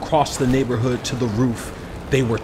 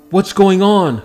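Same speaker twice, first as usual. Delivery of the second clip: higher, louder confused shout; yelled; 7 semitones higher, 6 dB louder than usual. A narrator's reading. Mother amid frozen soldiers, yelling in confusion. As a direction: call out bewildered. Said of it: "Kamal's mother yelled".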